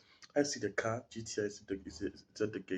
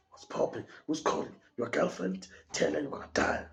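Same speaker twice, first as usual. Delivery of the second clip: higher, sneering voice of a taunting thug; mocking gunman; 6 semitones higher than usual. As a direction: taunt him like a cruel gangster. Gunman mocking him over phone call.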